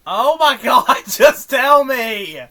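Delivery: loud, high, drawn-out plea; exasperated shout. Real, not acted. Listener begs SpongeBob to just tell the secret, exasperated shouting. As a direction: shout it in exasperation, stretching the words. Impatient at the stalling secret-teller.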